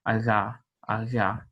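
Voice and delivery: "aga" with a rubbed G in the back of the mouth; velar fricative demo. Shown as a demonstration. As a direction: let the G rub at the back. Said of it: "voiced velar fricative".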